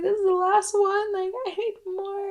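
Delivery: high whiny plea. Retold or imitated. Pleading when yoga ends.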